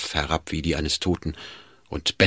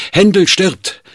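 loud, high-pitched call of alarm; urgent cry; 12 semitones higher, 7 dB louder, slower than usual. A narrator's reading. Schmidt calls to the Duke in his carriage, crying out. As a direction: cry out the terrible news. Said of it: "rief er dem Herzog zu".

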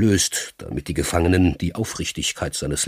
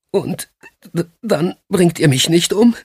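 higher, stammering plea; frightened prisoner; 11 semitones higher, 5 dB louder than usual. Acted. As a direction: ask in fear, voice rising. Frightened prisoner asks for his life.

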